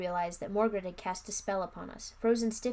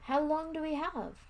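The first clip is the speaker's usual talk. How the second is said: small, higher frightened question; tiny trembling voice; 8 semitones higher than usual. Acted. Girl asking the wizard how long they have, small and scared. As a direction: ask it as a scared little child. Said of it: "I asked in a tiny, trembling voice".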